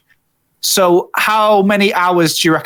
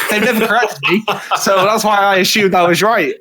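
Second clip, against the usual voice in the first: higher-pitched, talking through real laughter; laughing; 6 semitones higher than usual. Real, not acted. Co-host excusing his mispronunciation to friends, laughing. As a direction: laugh while owning up to the mistake.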